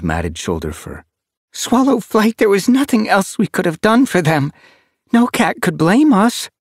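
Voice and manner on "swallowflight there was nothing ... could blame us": much higher, louder she-cat voice, consoling; comforting she-cat. A narrator's reading. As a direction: reassure him it was not his fault.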